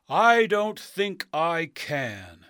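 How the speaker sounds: loud, very slow refusal; grand wizard voice. Acted. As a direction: draw it out grandly.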